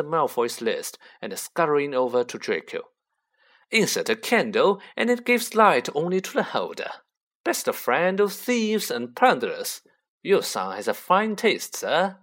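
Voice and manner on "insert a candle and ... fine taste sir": higher, eager salesman's voice; obsequious dealer pitched up; fawning shopkeeper. Acted. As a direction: show off the wares to flatter a customer. Voices Mister Borgin.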